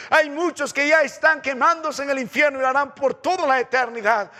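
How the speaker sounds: loud, high preaching at full force; fiery preaching. Acted. Preacher warning the congregation of hell, at full force. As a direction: thunder it from the pulpit.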